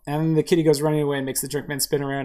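usual speaking voice

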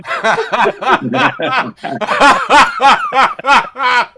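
high, loud burst of laughter; loud howling laugh. Real, not acted. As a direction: lose it completely laughing. Big laugh at the joke.

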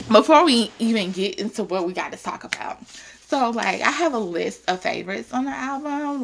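usual speaking voice

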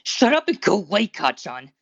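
loud, high-pitched angry retort; growling back. A narrator's reading. Boy leaning against the door to shut classmate out, growling back. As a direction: snap back loudly, easing off on the name. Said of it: "Deku growled back".

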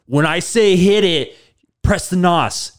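hammed-up coach calling from the side; mock yelling instructions.

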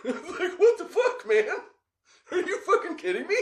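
high-pitched laughing disbelief, voice jumping way up; incredulous squeal. Retold or imitated. Teen protesting to the driver, high incredulous laughing squeal. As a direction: squeak it out like a scared kid who can't believe it.

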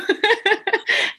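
high burst of real laughter; a happy giggle. Real, not acted. Guest laughing after thanking the host, real laugh.

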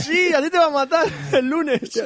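high, laughing teasing; laughing tease. Real, not acted. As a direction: tease him through a laugh. Teasing during group laughter.

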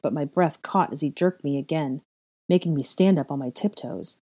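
ordinary delivery, nothing marked

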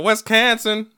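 "Wisconsin" pitched high, said as "wes-CAN-sin"; high mocking "Wisconsin", as "wes-CAN-sin". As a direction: mimic "Wisconsin" with a punched "can" in the middle. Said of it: "that fucking accent".

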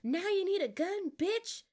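high, loud taunt; acted outraged yell. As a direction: shout it up high, challenging a cheating opponent. Acting out Crystal's taunt mid-fight.

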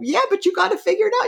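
high, fast and laughing demand; pushy self. Acted. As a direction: laugh while pushing the mind to solve it. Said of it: "We go".

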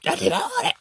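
squeaky high disbelieving repeat; fed up with Jennifer; exasperated. Acted. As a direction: squeal in frustration.

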